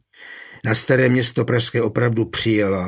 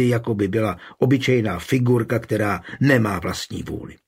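ordinary delivery

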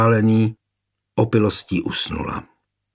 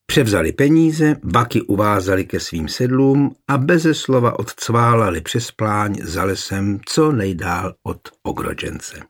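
ordinary delivery, nothing marked

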